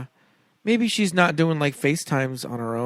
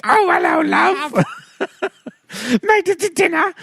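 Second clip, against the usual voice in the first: very high, louder Python granny voice; Monty Python falsetto; 5 dB louder than usual. Retold or imitated. Queen greets a dinner guest in Monty Python falsetto. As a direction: screech it like a Python old lady. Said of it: "It's gotta be the Monty Python".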